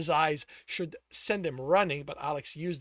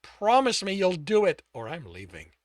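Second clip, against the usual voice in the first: stern; 2 semitones higher, 9 dB louder than usual. Acted.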